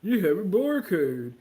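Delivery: put-on slow, loud, dopey boy voice; mock a clerk slowly catching on; clueless store clerk. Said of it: "He was like".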